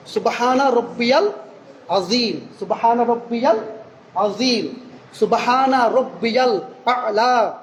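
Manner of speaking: slow, careful "সুবহানা রব্বিয়াল আ'লা" with full vowels; correct recitation. Shown as a demonstration. Preacher showing correct pronunciation of "আ'লা". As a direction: recite "আ'লা" slowly and precisely. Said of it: "সঠিকভাবে উচ্চারণ করার তৌফিক".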